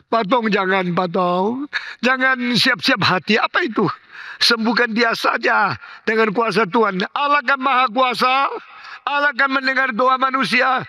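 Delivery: high, urgent pleading; pleading wife. Retold or imitated. Wife begging pastor to heal her husband, high and urgent. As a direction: beg the pastor desperately to heal him.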